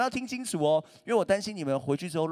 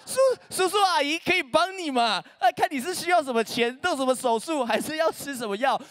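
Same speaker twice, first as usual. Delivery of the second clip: higher, louder nagging auntie, hammed-up; nosy parent; 11 semitones higher, 3 dB louder than usual. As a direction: pester him about his hidden illness. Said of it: "他就是一直问说".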